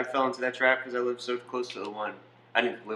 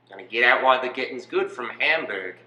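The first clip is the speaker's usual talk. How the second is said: matter-of-fact mock Australian accent; Australian Nazi. Nazi excusing himself as following orders, mock Australian accent. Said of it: "You're an Australian Nazi".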